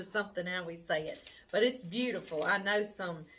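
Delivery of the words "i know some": ordinary delivery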